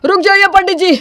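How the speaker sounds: loud, high call to halt; desperate shout. Acted. Groom bursting in to stop the priest, shouting. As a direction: shout across to stop it at once.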